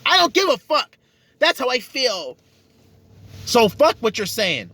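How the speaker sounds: wife waving off her husband, mock-defiant; defiant dismissal. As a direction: toss the facts aside with attitude.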